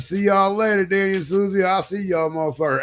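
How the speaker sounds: high singsong farewell; mock-friendly high send-off; acted cheery goodbye. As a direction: call out goodbye in a high, innocent lilt. Calling goodbye while sneaking off.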